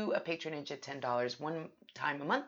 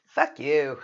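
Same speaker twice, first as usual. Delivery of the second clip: loud; 12 dB louder than usual. Acted. Joking swipe at listeners.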